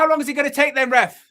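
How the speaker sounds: high, loud plea to the ref; pleading with the referee. Real, not acted. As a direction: yell at the ref for the whistle. Fans shouting at referee, stoppage time.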